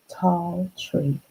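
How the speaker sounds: ordinary delivery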